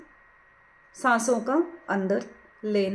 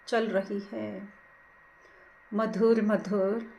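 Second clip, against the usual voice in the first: guided meditation; 4 dB quieter than usual. Acted. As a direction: ease them gently into watching the breath, unhurried. Leads a breath meditation. (धीमी, कोमल ध्यान कराने वाली आवाज़)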